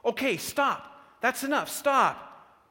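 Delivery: high, loud, desperate cry to stop; acted tearful child crying out; distressed pleading. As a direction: beg them urgently to stop. Boy begs for beating to stop.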